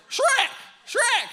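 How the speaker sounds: high, squawking Donkey yelling Shrek; donkey impression. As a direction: shout Shrek like the cartoon donkey. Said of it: "That's my best, uh, donkey impression".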